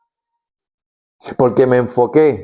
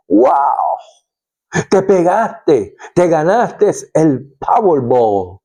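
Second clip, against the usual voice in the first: higher, slow, hammed-up thrilled announcement; excited exclaiming; 4 semitones higher, slower than usual. Stranger bursting in to tell Saúl he won, excited and higher. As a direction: burst in with big news, drawn out. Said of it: "y entra exclamando y me dice".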